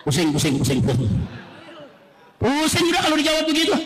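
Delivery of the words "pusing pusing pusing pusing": low, repetitive groaning complaint; muttered exasperation. Real, not acted. Preacher reacts to official's answer, low exasperated muttering. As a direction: mumble it low, as if one's head spins.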